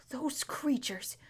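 trailing into a slow frightened whisper; can barely say the words, whisper them; fearful whisper. A narrator's reading. Horse naming the lions it dreads, whispered.

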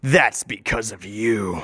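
low, slow accusation; deep, drawn-out inner voice; deep-voiced brain. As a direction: play the brain, deep and deliberate. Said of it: "The brain has a deep voice".

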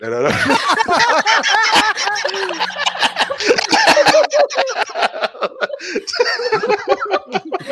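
breaks into a long, high, breathless laugh; can't stop laughing at the punchline. Real, not acted. Big laugh after joke punchline.

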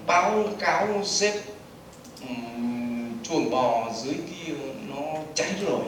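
slow, low and soft report to the boss. Shown as a demonstration. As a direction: drag the bad news out slowly. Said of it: "nói nhanh hay nói chậm".

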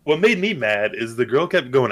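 usual speaking voice

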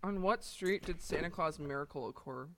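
usual speaking voice

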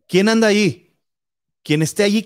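scared man calling into the dark bedroom. Retold or imitated. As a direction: call out into the dark room. Frightened man calls into dark.

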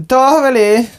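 high, loud call of a name; huuto. Acted. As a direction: shout the name across the street. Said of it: "Hän huusi". (kovaääninen huuto)

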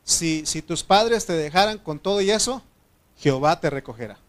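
loud, emphatic scripture quotation; scripture declamation. Acted. Preacher quoting a promise to the congregation, loud scripture declamation.

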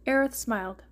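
usual speaking voice

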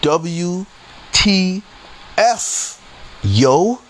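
W, T, F each high and set apart; emphatic spelling. Real, not acted. Storyteller underlining disbelief, W, T, F separately punched high.

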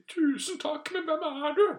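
very high comic queen voice; hammed-up high-pitched queen; Dronning Sonja. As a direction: squeak out thanks, then puzzlement. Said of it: "greide faktisk dronning Sonja å si".

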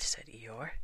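ordinary delivery

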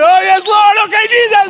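thrilled exclamation of thanks to Jesus; ecstatic praise. Retold or imitated. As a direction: throw it up to the heavens in delight. Pastor's reaction to answered prayer.

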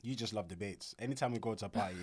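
ordinary talk

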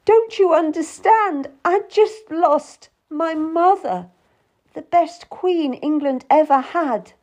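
very high, loud, anguished appeal; tearful outburst. A narrator's reading. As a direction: cry out in grief. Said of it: "I started to cry".

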